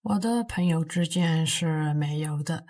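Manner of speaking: ordinary talk, nothing marked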